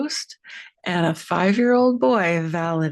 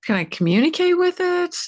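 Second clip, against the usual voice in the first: eager wonder; 12 semitones higher, 3 dB louder, faster than usual. Retold or imitated. Retold hopeful inner question.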